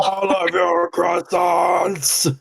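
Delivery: slow, high-pitched mock battle cry; drawn-out shout. Player's character storms the bakery, shouting a drawn-out demand. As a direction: stretch it out as a hammed-up demand.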